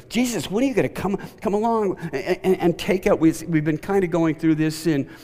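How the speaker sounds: high, fast eager plea; impatient disciples. Retold or imitated. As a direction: rush it out like eager followers. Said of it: "they're thinking".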